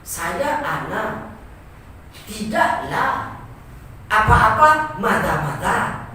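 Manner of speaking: drawn-out, halting student Arabic; slow mock broken Arabic. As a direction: spell out the clumsy Indonesian-style Arabic slowly. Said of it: "sesuai dengan gaya Indonesia".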